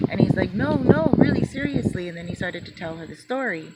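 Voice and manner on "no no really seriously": higher and louder pleading; insistent. Retold or imitated. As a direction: insist urgently he means it. Jose insisting.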